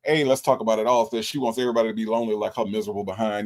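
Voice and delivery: usual speaking voice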